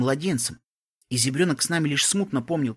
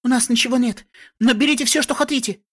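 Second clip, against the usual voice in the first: high, loud frightened pleading; panicked shout; 10 semitones higher, 6 dB louder than usual. A narrator's reading. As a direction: plead loudly, begging for mercy. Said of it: "крикнула она".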